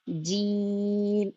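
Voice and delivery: جیم drawn out very slowly; جیم stretched long and level; long jeem. Shown as a demonstration. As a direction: hold جیم for five to six counts. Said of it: "five to six count".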